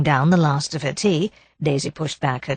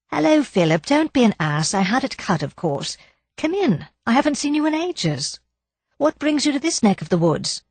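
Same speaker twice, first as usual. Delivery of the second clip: put-on higher, breezy young lady; lightly voiced young woman; 8 semitones higher than usual. Daisy welcoming Philip at the door, breezy and bright. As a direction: greet an old friend brightly.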